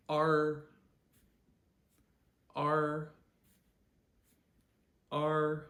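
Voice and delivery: R as the letter's name, high and level; first tone. Shown as a demonstration. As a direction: hold R loud on one high, steady note. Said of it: "say the name of the letter R".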